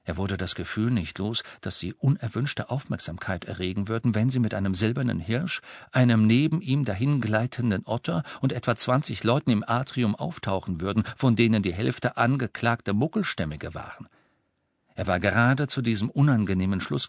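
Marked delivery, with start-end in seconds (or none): none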